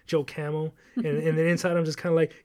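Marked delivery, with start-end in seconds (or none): none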